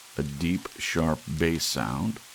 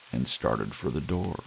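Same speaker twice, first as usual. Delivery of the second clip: usual speaking voice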